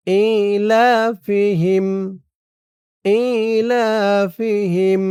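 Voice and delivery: ইলাফিহিম slow, vowels stretched, rising; tajweed recitation. Shown as a demonstration. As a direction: draw out ইলাফিহিম chant-like. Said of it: "খেয়াল করবেন আমি কোথায় কেমন উচ্চারণ করি". (ইলাফিহিম ধীরে টেনে তিলাওয়াত)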